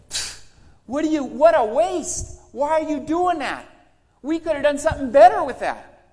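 high, loud scoffing sneer; scoffing disciples. Retold or imitated. Disciples mocking Mary's gift, loud high scoffing sneer. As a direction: scoff at the wasted perfume. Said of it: "they start saying".

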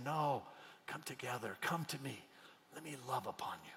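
God calling the wounded near, tender; tender invitation. Retold or imitated. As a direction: coax them in softly.

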